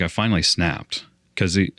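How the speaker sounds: ordinary talk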